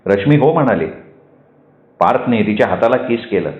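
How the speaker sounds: ordinary delivery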